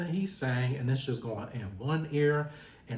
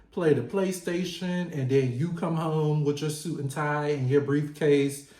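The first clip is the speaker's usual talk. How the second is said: ordinary delivery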